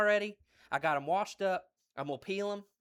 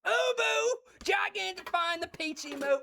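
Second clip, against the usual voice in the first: excited shout-out; 11 semitones higher, 4 dB louder than usual. Real, not acted. Greeting chat viewer.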